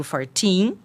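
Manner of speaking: put-on "fourteen" with the weight on the final teen; "fourteen" clearly set apart from "forty"; stressed teen. Shown as a demonstration. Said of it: "é outra coisa".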